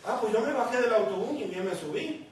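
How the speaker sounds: ordinary delivery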